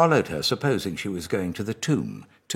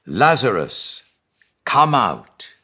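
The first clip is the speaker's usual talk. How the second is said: ringing shout, much louder and higher; Jesus calls into the tomb, commanding shout; 4 semitones higher, 11 dB louder, slower than usual. Acted. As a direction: call him out of the tomb with full voice. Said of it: "Jesus called in a loud voice".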